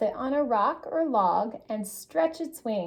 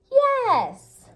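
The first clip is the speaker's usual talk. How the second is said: high, loud cheerful yes; excited yes; 11 semitones higher, 6 dB louder than usual. A narrator's reading. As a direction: answer with a delighted yes. Bright answer to question.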